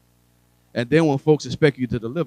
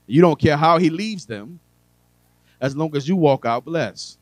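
preaching climax; 4 semitones higher, 5 dB louder than usual. Real, not acted. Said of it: "preaching climax".

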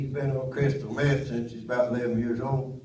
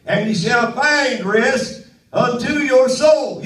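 higher, louder revival preaching; full-force preaching; 11 semitones higher, 10 dB louder than usual. Acted. Preacher rousing his congregation at full force. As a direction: lift the congregation, preach it hard.

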